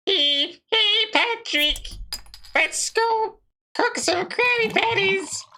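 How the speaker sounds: very high, slow cartoon sponge voice; SpongeBob impression. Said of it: "do your best SpongeBob impression right now".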